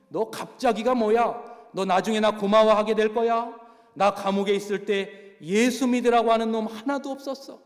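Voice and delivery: retold prisoner loudly rebuking a hesitant inmate; angry rebuke. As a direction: snap back at his excuse. Go Jae-bong rebukes the prisoner.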